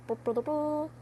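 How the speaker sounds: high, soft mimicked toots; light vocal fanfare; horn fanfare imitation. Shown as a demonstration. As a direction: toot a little celebratory horn. Horn fanfare for student's boast.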